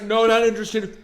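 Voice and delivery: high, loud refusal through laughter; mock brush-off. Shopper turning away a Costco salesperson, in a loud high brush-off.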